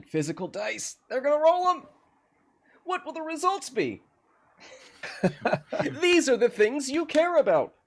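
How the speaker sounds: very high, giddy excited squeal; over-the-top enthusiasm. Pretend. Streamer hyping up physical dice for viewers, gleefully over-excited. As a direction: gush at high pitch, breaking into laughter. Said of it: "Is that enthusiasm coming through?".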